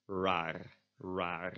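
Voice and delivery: "raar" with English first R and rolled last R; mismatched R's. Shown as a demonstration. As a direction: start "raar" untrilled, roll its final R. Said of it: "if you do the first R as an English R".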